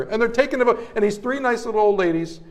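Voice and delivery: ordinary delivery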